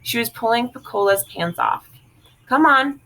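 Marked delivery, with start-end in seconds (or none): 2.5-3.0 urgent call. Acted. Frida pulling off Piccola's pants.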